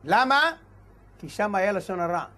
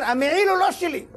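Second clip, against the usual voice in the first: high-pitched insistent denial; pitched-up retold protest; mimicked disclaimer; 8 semitones higher than usual. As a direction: imitate someone eagerly disowning it.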